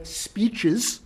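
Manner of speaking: "speeches" set apart and drawn out; stress on speeches. Real, not acted. Lecturer flags the assistant attorney general's speeches, stress on "speeches". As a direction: lean slowly on the word "speeches". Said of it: "I emphasize the word".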